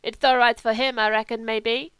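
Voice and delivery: put-on German accent; mimicking Kurt's accent. A narrator's reading. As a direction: mimic a German friend's English. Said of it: "mimicking Kurt's accent".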